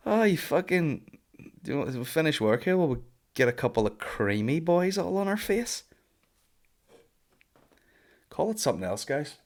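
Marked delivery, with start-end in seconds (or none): 0.1-5.8 much higher, mock-lusty voice of grown men. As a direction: ham up a grown man drooling over a pint. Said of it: "You got grown men saying".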